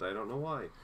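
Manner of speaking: normal talking voice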